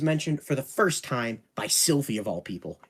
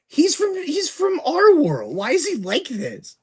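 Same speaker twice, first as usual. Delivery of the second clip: incredulous; 13 semitones higher, 5 dB louder than usual. Real, not acted. Disbelief at Rudius's views.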